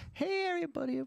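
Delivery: "Hey, everybody" pitched high; starting high. Retold or imitated. Husband mocking his wife's greeting: high start. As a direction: open way up high. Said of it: "She'll start high".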